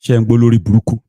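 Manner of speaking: loud, fast scornful jab; mocking scorn. Real, not acted. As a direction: sneer at the voice note. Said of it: "He's saying there in that voice".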